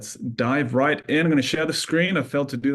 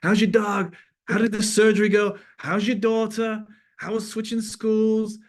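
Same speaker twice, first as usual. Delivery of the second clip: high, louder cheery American accent imitation; imitating an American accent; 10 semitones higher, 4 dB louder, slower than usual. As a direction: rattle off friendly questions in a mock American accent. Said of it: "He's got his great, beautiful American accent".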